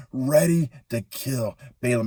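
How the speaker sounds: plain reading voice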